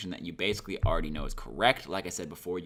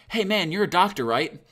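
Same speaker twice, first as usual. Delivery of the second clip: much higher and louder, calling out across the street; confident call-out; 10 semitones higher, 7 dB louder than usual. Shown as a demonstration. Calling to a passing doctor for a hurt buddy, loud and sure. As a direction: hail him, already sure of the answer. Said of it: "It's not like this".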